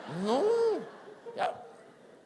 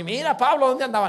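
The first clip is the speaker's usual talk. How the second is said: loud, raised teasing question; mock accusing gossip; 4 semitones higher, 9 dB louder than usual. A busybody teasing Pablo about the tavern, loudly. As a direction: needle him as a busybody.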